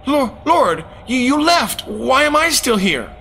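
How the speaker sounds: frantic cry. Retold or imitated. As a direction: cry out, abandoned and scared. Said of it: "I cried".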